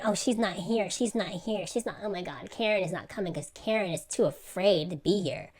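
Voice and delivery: quiet, repetitive mock fretting; mock gossip. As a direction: drop the volume to mimic petty murmured chatter. Mimicking the girls' gossip.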